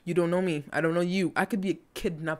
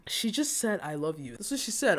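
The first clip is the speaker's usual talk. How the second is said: soft, subdued repeat, sinking at the end; quiet, overcome murmur; moved near tears; 4 dB quieter than usual. Real, not acted. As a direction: let the voice drop away tenderly.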